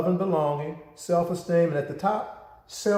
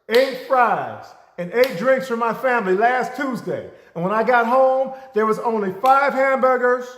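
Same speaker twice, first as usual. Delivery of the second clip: loud, high, insistent rant; indignant complaint; 7 semitones higher, 5 dB louder than usual. Retold or imitated. Customer to manager listing the shorted order, loud and insistent. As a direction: list every item like you've been robbed.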